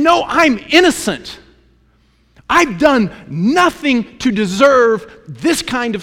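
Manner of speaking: high, loud insistent protest; indignant protest. Retold or imitated. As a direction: cry out your innocence to the accusers. Job protesting his innocence.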